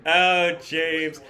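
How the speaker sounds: high, loud, thrilled greeting; excited. Real, not acted. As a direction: cheer for your buddy. Sees friend on screen.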